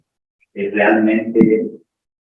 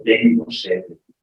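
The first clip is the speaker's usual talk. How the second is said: voice of Jesus; 8 semitones higher than usual. Retold or imitated. God voiced speaking to Teresa.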